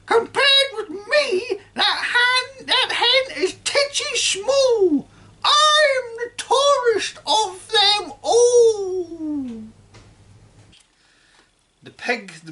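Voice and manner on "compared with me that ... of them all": put-on loud screeching old woman, boastful; grandma boasting down from the roof; high sing-song grandma call. A narrator's reading. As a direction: sing it out, bragging. Grandma voiced throughout.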